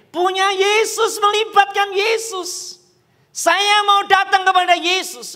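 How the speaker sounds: high, drawn-out proclamation; fervent preaching. Real, not acted. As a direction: proclaim it slowly to the whole congregation. Climax of the preacher's exhortation.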